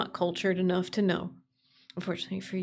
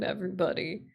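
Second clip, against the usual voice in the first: normal talking voice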